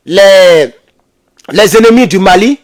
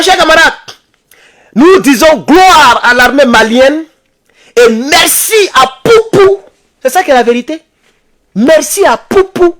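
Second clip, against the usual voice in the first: triumphant salute; 8 semitones higher than usual. Real, not acted. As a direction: proclaim it like a victory toast. Closing salute to the army. (salut triomphant, aigu et lent)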